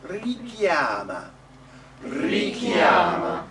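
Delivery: drawn-out "Richiama" with one short K; single K. Shown as a demonstration. Coach modelling the word for singers, single K in "Richiama". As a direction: stretch "Richiama", never holding the K. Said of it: "Be careful not to double the k".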